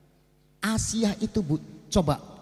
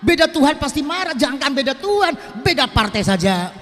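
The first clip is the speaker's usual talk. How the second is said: higher, louder preaching emphasis; emphatic preaching; 6 semitones higher, 4 dB louder than usual. Real, not acted. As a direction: drive the point home to the congregation. Preacher stresses Pharaoh's anger.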